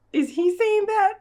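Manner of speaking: high, loud disbelieving squeak; a high, loud is he saying that; incredulous. Real, not acted. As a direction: disbelief at the line. Incredulous at a line of dialogue.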